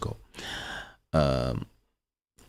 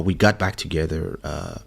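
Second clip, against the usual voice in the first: normal talking voice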